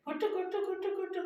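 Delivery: very high, squeaky parrot chatter; parrot squawk imitation. Owner copying his parrot Cocktail's calls, very high chirpy squeak. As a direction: mimic the bird's little kutu calls. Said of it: "cocktail भी अभी हमारा बोल रहा है".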